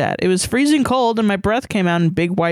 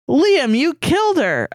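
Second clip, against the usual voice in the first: higher, slow, drawn-out accusation; Irish boy; 7 semitones higher than usual. A narrator's reading. Irish boy accuses Liam, slow and higher-pitched. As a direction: voice a boy reproaching his friend, stretched out. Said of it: "I heard the Irish boy say".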